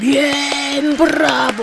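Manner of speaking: high excited cheer. A narrator's reading. Crowd cheering the speech. (vítores entusiastas)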